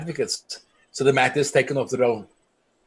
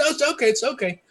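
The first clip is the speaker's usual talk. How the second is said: much higher-pitched retold reassurance; placating; 10 semitones higher, faster than usual. Villager placating the angry activist over the dog, high-pitched reassurance. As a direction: quote a quick placation, voice jumping high.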